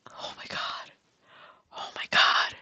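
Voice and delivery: unvoiced, choked whisper; teary whisper. Real, not acted. Gamer overwhelmed as her villager leaves, teary whisper. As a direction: whisper through happy tears. Overcome with joy.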